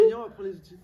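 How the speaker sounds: high-pitched. Pretend. Sudden very high call.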